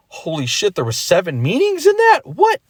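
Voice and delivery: hammed-up disbelief that builds to a shriek; big mock amazement.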